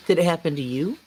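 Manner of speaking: ordinary delivery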